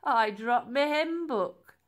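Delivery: playful, drawn-out non-apology; chuckled. Acted. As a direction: draw it out with amused fake innocence. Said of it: "he chuckled".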